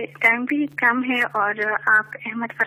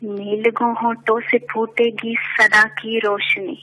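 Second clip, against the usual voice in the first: measured poetic recitation, lower; stately nazm recitation; 4 semitones lower, faster than usual. Acted. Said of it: "ایک نظم پیش کرنا چاہوں گی".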